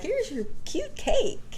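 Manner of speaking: high, slow sweet mom voice; high sing-song mom offering cake. Retold or imitated. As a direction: coo it like a proud mom. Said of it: "my mom was like".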